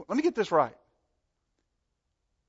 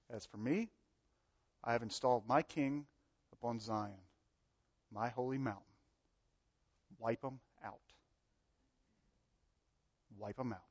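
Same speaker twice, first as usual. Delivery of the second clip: much softer, low, ominous pronouncement; quiet grim decree; 5 semitones lower, 10 dB quieter than usual. Acted. God declaring judgment on the kings, quiet grim decree. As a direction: say it low, like a verdict.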